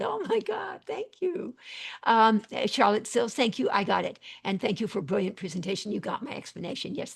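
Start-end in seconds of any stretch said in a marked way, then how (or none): 0.0-1.5 delighted surprise. Real, not acted. Thrilled by a chat comment.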